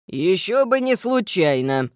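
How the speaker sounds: gruff stern gnome teacher. Acted. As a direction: scold like a strict old gnome. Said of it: "ещё более строго отозвался гном".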